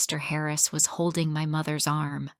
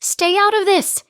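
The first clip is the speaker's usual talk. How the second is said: much higher, louder furious shout; 14 semitones higher, 8 dB louder than usual. Acted. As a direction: yell it down at her. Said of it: "he shouted".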